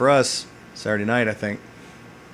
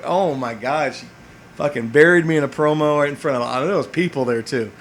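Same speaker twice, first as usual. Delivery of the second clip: usual speaking voice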